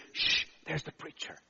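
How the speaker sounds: whispered imitation of a wary warning; hushed voice. Group leader warning his group on seeing the preacher, hushed. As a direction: hush it right down to a whisper. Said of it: "he said almost in a hushed voice".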